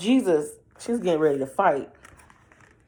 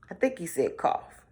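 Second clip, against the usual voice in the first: usual speaking voice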